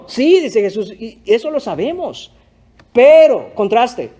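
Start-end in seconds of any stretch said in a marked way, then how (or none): 2.9-3.5 preacher underlining the contrast in Jesús's words, loud stress on Pero; "Pero" punched loud and higher, falling at the end. Shown as a demonstration. Preacher quoting Jesús to the congregation, stressed Pero. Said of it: "note esa palabra pero".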